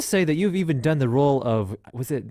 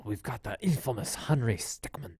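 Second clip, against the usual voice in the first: soft, slow fake Russian accent; a half-hearted, not quite Russian accent; half-attempt Russian accent; 2 semitones lower, 8 dB quieter than usual. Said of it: "sort of trying to do Russian".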